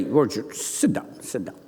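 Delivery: low, soft order fading further; quiet rebuke. Acted. Pastor tells interrupting Ward to sit, low quiet rebuke. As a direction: put him back in his seat quietly.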